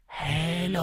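high, thin, soft ghostly greeting; a creepy spirit trying out English; ghost's eerie hello. Acted. Possessed woman's ghost greets the exorcist, high and eerie. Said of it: "nó lại còn nói cả ngoại ngữ".

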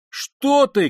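high, loud cry of alarm; sharp high-pitched yell; startled shout. A narrator's reading. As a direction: shout it suddenly to stop someone. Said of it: "крикнул Разумихин".

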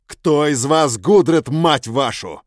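loud, slow, cursing shout; angry shout. Acted. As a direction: bellow it in fury. Hero demands, cursing.

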